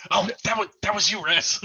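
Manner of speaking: mock re-enact her own surprised reaction; imitating herself. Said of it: "and I'm like".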